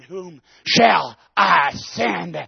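God's voice calling out for someone to send; slow solemn call. Acted. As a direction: let each word hang heavily. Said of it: "a voice said".

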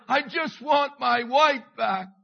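slow, loud, desperate cry; anguished cry. Shown as a demonstration. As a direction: wail it out in agony. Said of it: "it's the emotion, it's the agony".

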